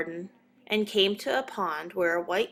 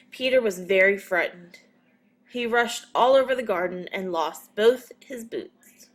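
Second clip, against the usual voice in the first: ordinary delivery, nothing marked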